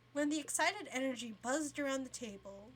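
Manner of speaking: ordinary delivery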